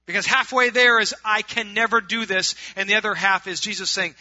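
loud, emphatic sermon voice; full-force preaching. Acted. Preacher explaining the halfway point to his congregation, full-force preaching.

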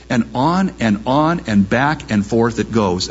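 normal talking voice

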